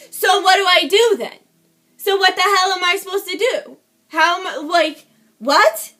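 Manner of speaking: loud, incredulous demand; retold exasperated protest. She challenges neighbors who won't call police, retold exasperation. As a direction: throw the questions out loudly, baffled.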